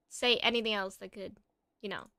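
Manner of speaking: ordinary talk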